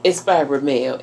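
ordinary talk

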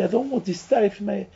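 high, begging cry; pleading stomach. Pretend. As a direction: cry out high, refusing the food. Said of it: "is screaming".

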